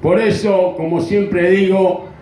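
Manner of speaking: ordinary talk, nothing marked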